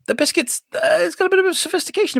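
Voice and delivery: high, fast prissy voice; mock posh. Host mocking his sophisticated biscuit show, high prissy voice. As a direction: send up the fancy biscuit show.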